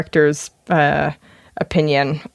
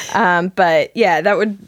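ordinary delivery

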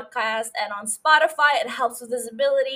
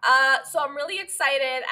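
usual speaking voice

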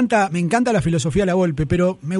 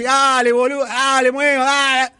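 a bossy coach barking at players, higher; mock coach yelling; 4 semitones higher, slower than usual. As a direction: scorn the players like a rude coach. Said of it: "Y usted va a llegar y dice". (entrenador despectivo, tono burlón)

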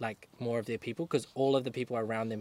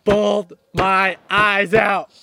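loud laughing exclaim; 8 semitones higher, 12 dB louder, much slower than usual. Real, not acted. Laughing recounting her sobbing.

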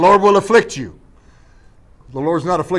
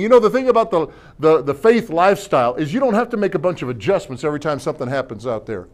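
ordinary talk, nothing marked

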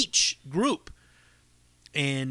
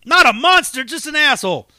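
higher and louder punchline; 7 semitones higher, 9 dB louder than usual. Real, not acted. Wrapping up the story for listeners, loud punchline. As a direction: land it loud.

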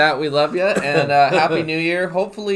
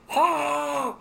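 alien scream; 13 semitones higher, 3 dB quieter than usual. Acted. Scaring as aliens.

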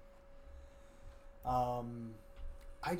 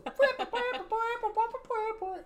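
sax mimicry; 4 dB louder than usual.

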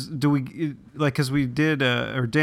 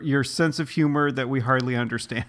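ordinary talk, nothing marked

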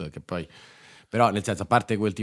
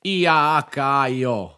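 spelled slowly; 6 semitones higher, 5 dB louder, much slower than usual. Real, not acted.

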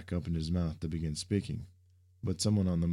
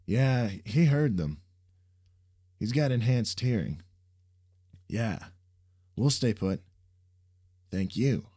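loud, higher into phone; confirming on the call; 6 semitones higher, 6 dB louder than usual. Acted. Sam back on phone.

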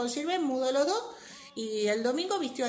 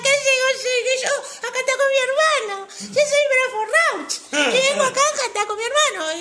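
Bradford; 11 semitones higher, 6 dB louder than usual. Acted. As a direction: burst in as an over-the-top stage guest. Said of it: "apareció Bradford y, y dijo".

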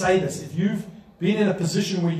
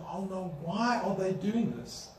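soft, fretful whimper of worry; worried lament; 8 dB quieter than usual. Shown as a demonstration. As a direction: sigh it out as if scared and helpless. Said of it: "We can say".

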